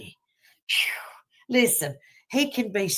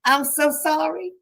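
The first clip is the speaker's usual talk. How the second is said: higher, mock-guilty plea to the preacher; sheepish apology; 8 semitones higher than usual. Head bowed in church, apologizing to the preacher, higher and mock-guilty. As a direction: play it like a caught churchgoer apologizing. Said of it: "sitting there with my head down like".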